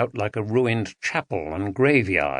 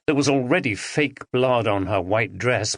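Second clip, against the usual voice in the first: ordinary delivery, nothing marked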